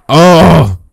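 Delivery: startled oh. Real, not acted. Sudden jolt during gunfight.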